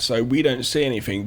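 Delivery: ordinary talk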